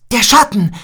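high, loud alarmed shout; frightened cry. Acted. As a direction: sound the alarm in fear. Children spot the dreaded shadow.

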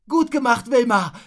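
loud, high praise; cheering call. A narrator's reading. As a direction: call out congratulations. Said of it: "rief Rotznase". (laut und hoch lobend rufen)

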